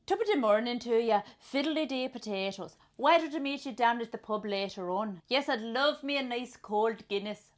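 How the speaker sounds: hammed-up Irish lilt; Irish accent. Player ad-libbing pub talk, hammed-up Irish accent. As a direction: play up a stage Irish accent. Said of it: "Okay, Irish".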